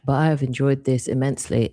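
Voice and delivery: ordinary talk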